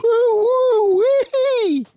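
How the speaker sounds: very high, loud, slow hooting; giddy whoop. Acted. Smallest fox whoops after gulping fiery cider. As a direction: let the drink set you whooping. Cub after strong drink.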